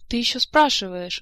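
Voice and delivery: acted indignant screech, kept short; outraged squeal. A narrator's reading. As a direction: snap back in shrieky outrage. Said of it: "взвизгнула графиня Манкар".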